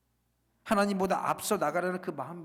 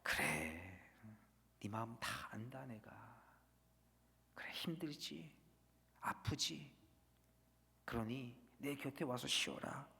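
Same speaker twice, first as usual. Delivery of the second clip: whispered, much lower and softer; soft voice of God; 5 semitones lower, 12 dB quieter than usual. Retold or imitated. God inviting weary Jonah to rest, whispered. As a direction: soothe the hurting prophet in a hush. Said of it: "하나님의 부드러운 음성이었습니다".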